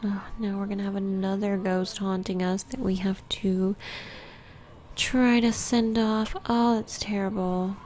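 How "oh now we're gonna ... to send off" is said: ordinary talk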